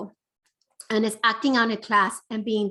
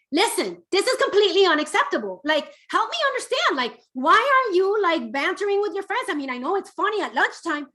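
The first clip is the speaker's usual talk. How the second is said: much higher and louder, fast, accusing lecture; harsh scolding tone; 10 semitones higher, 6 dB louder, faster than usual. Shown as a demonstration. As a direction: demo of how not to talk to your teen, sharp and exasperated. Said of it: "and notice my tone. I'm not saying".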